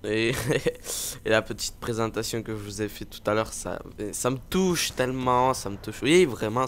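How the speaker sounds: ordinary talk, nothing marked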